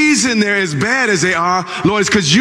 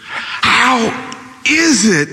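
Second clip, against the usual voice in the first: tearful plea; 6 semitones higher, much slower than usual. Acted. Anguished complaint, crying heard.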